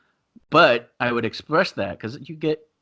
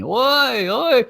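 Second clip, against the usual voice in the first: high, loud ringside holler; imitated crowd yell; 12 semitones higher, 4 dB louder than usual. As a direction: yell like the crowd after a kick. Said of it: "that's what everybody's yelling".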